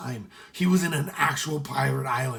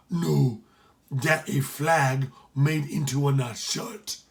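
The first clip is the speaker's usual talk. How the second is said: easygoing Jamaican pirate voice; Jamaican accent; slower than usual. Acted. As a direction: correct him in a Jamaican accent. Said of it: "The Jamaican".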